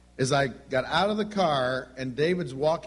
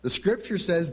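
ordinary talk

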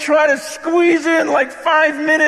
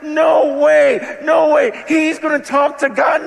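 loud, raised astonished exclamation; amazed crowd; 4 semitones higher, 5 dB louder than usual. Retold or imitated. Israelites watching Moses, shouting in disbelief.

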